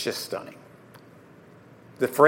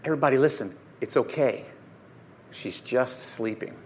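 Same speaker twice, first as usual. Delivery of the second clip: soft, low, slowing to a hush; gently quiet a grieving household; calm reassurance; 5 semitones lower, 9 dB quieter than usual. Acted. Jesus calms the grieving household.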